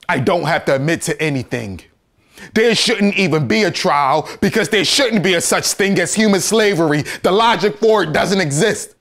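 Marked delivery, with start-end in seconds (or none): 2.5-8.9 high, loud courtroom outburst; raised, urgent denunciation; impassioned protest. Acted. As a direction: argue it at full voice.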